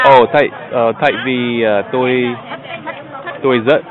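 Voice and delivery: halting learner Vietnamese, full of pauses; searching for words, American accent; foreigner broken vietnamese. Real, not acted. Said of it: "nói tiếng Việt Nam rất dễ thương". (tiếng Việt bập bẹ của người nước ngoài)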